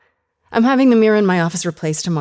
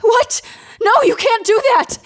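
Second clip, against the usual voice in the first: higher, loud outraged protest; shocked protest; 6 dB louder than usual. Acted. Hearing the recording will be wiped, shouting in protest. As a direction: shout it, appalled.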